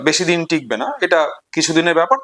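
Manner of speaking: normal talking voice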